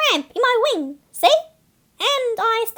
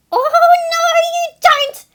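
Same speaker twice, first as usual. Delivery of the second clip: high, louder indignant snap; 8 semitones higher, 6 dB louder than usual. Acted. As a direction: stop the thief cold. Seagull stops the snack thief.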